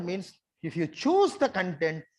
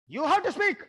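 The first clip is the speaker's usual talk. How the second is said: raised, forceful command; loud command; 10 semitones higher, 5 dB louder than usual. Shown as a demonstration. Facilitator pushing shy audience to speak, loud and forceful. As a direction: insist they speak, much louder and higher. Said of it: "look at my gesture".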